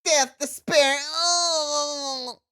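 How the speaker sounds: high wail of despair; whiny client voice. Acted. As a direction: moan it high, drawing out the last cry. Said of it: "That's my whiny voice".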